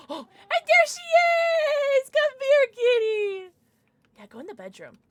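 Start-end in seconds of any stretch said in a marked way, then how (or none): 0.1-3.5 high, loud, slow joyful call; delighted cry. Real, not acted. Spotting her lost cat, she cries out high and loud.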